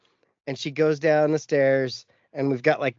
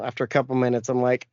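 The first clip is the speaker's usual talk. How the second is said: ordinary delivery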